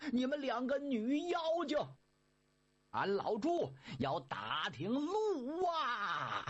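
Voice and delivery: high, slow, drawn-out rude call; rude bellow. Acted. Pigsy rudely calling to the girls at the well, drawn-out. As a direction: swagger and glare at the girls. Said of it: "横眉溜眼儿的".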